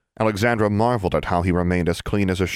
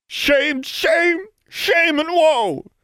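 much higher, louder, slowing theatrical wail; wailing lament; 5 dB louder, much slower than usual. A narrator's reading. Goody Pruitt wailing over the dedication. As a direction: mourn it like a melodrama. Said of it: "cried Goody Pruitt".

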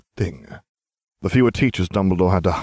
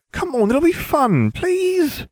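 put-on a much higher, whiny boy voice; whining; slower than usual. A narrator's reading. Ron whining at Harry to come, much higher. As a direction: plead like a needy little boy. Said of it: "Ron whined".

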